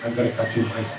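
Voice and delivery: mock Cuban accent. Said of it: "Al Pacino's Cuban".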